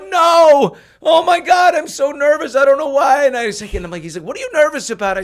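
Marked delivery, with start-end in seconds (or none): 0.1-3.3 loud, high, panicked outburst; exploding nervously. Retold or imitated. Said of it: "And I explode". 4.3-5.2 loud, high puzzled question; Jerry asking. Retold or imitated. Jerry asks back, retold.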